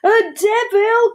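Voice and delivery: high, loud, drawn-out cry of alarm; slow, high dramatic wail. A narrator's reading. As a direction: cry out as if you've seen a devil. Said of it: "cried the Deinonychus".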